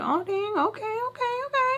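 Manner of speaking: very high, held-level okays; put-on polite interest. Humouring her as she shows her stuff, high put-on interest.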